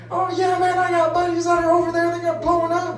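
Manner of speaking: high bro voice confiding; lightly hammed concert guy; mock confiding bro. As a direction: tell it like a sad buddy.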